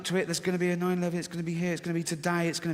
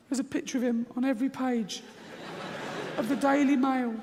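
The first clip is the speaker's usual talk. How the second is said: high, laughing scaremonger voice; fearful inner voice; 8 semitones higher than usual. Retold or imitated. Fearful inner voice arguing with reason, high and giggly. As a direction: pitch it up as the frightened gut talking. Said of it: "this one goes".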